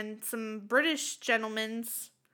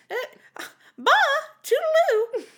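high, loud posh farewell; queen's goodbye; 11 dB louder, slower than usual. Acted. Host as the Queen signing off the podcast. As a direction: sing out a royal goodbye. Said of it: "The queen had to go".

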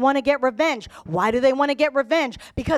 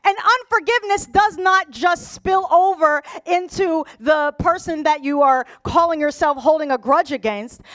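high, loud sermon delivery; raised voice, preaching at full force; impassioned preaching; 3 semitones higher, 3 dB louder than usual. Real, not acted. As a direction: drive the point home to the congregation.

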